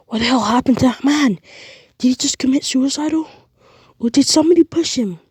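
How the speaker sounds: high, loud bewildered questions; shocked cops. Acted. Police officers shocked by the fallen man, loud bewildered questions. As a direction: gasp it out in disbelief.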